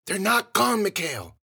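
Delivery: high, strained call; high, urgent protest; urgent warning. A narrator's reading. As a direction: call out a warning across the street. Sister calling back in warning.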